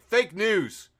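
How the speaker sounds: high, loud two-word shout; mock outcry. Host imitates Chelsea's comment on a headline, mock outcry. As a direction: blurt it out loud and high, mocking. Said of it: "She's just like".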